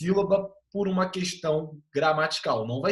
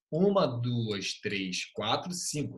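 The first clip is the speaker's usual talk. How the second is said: slow syllable-by-syllable count, fading softer; 5 semitones lower, 5 dB quieter, slower than usual. Shown as a demonstration. Teacher counts syllables for students, slowly. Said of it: "Por exemplo".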